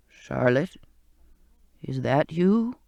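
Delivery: put-on a weak old sailor calling her name; old sailor's voice. Ghostly old cook calling to Charlotte in the dark.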